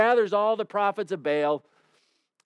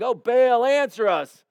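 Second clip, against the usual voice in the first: shouted cry; 6 semitones higher, 4 dB louder, slower than usual. A narrator's reading.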